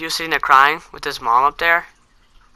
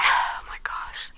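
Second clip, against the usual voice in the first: slow, breathy whispered groan; exasperated whisper; 8 dB quieter, much slower than usual. Retold or imitated. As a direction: sigh it out under your breath. Exasperated kid sent to office.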